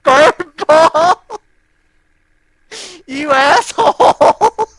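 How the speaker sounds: high, strained wheezing laugh; laughing hard. Real, not acted. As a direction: squeal through helpless laughter. Cracking up at friend's joke.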